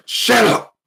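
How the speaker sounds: screaming shut up, very high and loud; shout. Retold or imitated. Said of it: "when they wanna be quiet".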